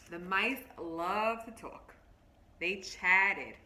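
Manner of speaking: lightly voiced story narration, drawing them in; reading to children. Teacher reading a picture book to her class, storybook voice.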